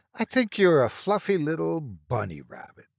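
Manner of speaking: high, sing-song mockery; sneering baby talk to a monster; mocking. A narrator's reading. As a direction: tease it like a child's pet. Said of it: "I said in a mocking voice".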